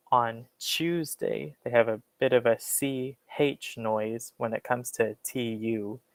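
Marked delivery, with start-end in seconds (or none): 0.1-1.6 Tuesday said British style with a CH-like sound, slowly; British accent. Shown as a demonstration. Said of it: "So in a British standard way, you could say".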